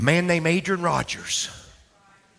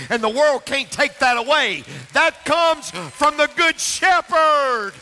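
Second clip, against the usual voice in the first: rising, much louder and higher preacher's cry; preaching at full force; 12 semitones higher, 3 dB louder than usual. Acted. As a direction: build it into a shouted sermon climax. Preaching climax.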